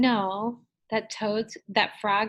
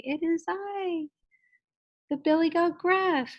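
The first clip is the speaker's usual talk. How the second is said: high-pitched storybook billy goat; 9 semitones higher than usual. Shown as a demonstration. Teacher acting out the goat for children, high goat voice. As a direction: answer the troll in a high, put-on goat voice. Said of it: "if you're the goat".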